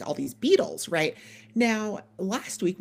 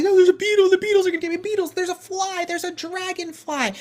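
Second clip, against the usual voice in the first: put-on high, loud whining of scared little kids; much higher kid voice; panicky child voice; 12 semitones higher, 7 dB louder than usual. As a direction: wail like a toddler afraid of bugs. Kids panicking about beetles.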